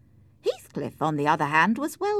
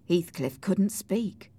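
plain reading voice

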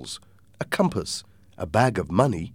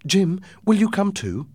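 mother's voice; 11 semitones higher, 4 dB louder than usual. Acted. Mother asks her son along.

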